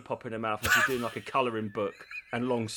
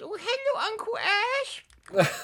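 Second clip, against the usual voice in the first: put-on very high, slow little-girl coo; high drawn-out childish greeting; much slower than usual.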